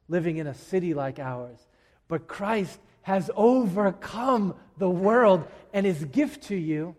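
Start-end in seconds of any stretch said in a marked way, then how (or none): 2.1-6.9 slow, louder, higher proclamation; proclaiming. Real, not acted. Preacher declaring victory to the church, slow and emphatic. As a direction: ring it out as good news. Gospel climax.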